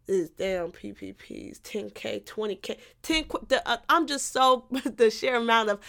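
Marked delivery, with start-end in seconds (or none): none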